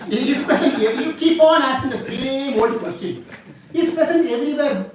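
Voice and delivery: high-pitched, comic insistence; exasperated son. Acted. Prahlad fed up with his father's old question, exasperated. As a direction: protest at being asked the same thing.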